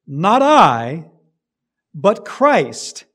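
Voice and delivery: "Not I" loud, then "Christ" falling firmly, with a pause between; emphatic contrast. Shown as a demonstration. Preacher showing a congregation Paul's emphasis, stressing "I" against "Christ". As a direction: punch "I" and "Christ". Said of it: "With the emphasis".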